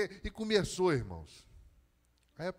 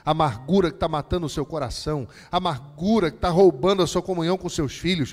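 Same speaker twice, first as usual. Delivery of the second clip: each amargura louder and higher, building to a shout; building sermon cry; 3 semitones higher, 14 dB louder than usual. Acted. Pastor hammering amargura at the congregation, building to a shout. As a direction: pile the phrases up to a roar. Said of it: "repeated climax amargura".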